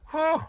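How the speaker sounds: sharp sneeze burst; quick real sneeze; sneezing. Real, not acted. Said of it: "is try to sneeze".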